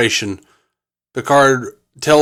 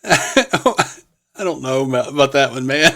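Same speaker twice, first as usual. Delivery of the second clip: normal talking voice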